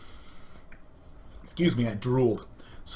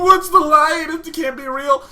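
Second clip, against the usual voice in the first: very high, loud panicked squeal; hammed-up shriek of disbelief; mock terror; 12 semitones higher, 8 dB louder than usual. Gamer terrified by a firefly in his room, mock terror.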